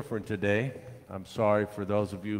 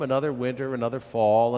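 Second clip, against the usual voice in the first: ordinary delivery, nothing marked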